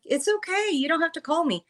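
high-pitched, light reassurance; acted-out high "it's okay"; retold polite protest. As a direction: wave off the offer in a high voice. Acting out reassuring the caller.

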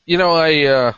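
usual speaking voice

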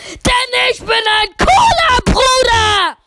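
very loud, high, slow shout; too loud. Acted. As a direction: blast it into the mic. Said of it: "lauteste Folge ever". (übersteuert lautes Brüllen)

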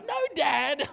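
high, loud mock objection; protesting child. Son objecting to dad's advice on bullies, protesting child. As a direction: imitate an appalled kid. Retold son's protest to dad.

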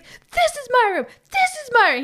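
high-pitched frat bro staking his claim; whiny, insistent high voice, imitating a bro; calling dibs. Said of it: "he's calling dibs".

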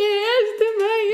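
high wail, imitating tearful self; mock crying. Daughter shows mum the photo, mock-tearful. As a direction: imitate crying while half amused. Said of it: "a chorar assim".

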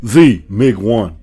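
low, slow announcer voice naming himself; co-host drops low and slow to announce his own name; announcer intro. Acted. Host introducing the Mig One in a slow, deep announcer voice.

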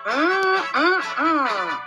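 drawn-out yummy humming; appreciative "mmm" while tasting; savoring hum. Acted. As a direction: hum with pleasure over a treat. Savoring the dessert.

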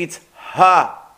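Ha with an airy h from the throat; breathy Hey. Shown as a demonstration. Teacher shows students the Hey sound: airy Ha. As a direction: push air out on Ha. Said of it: "And Hey".

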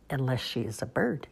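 plain reading voice

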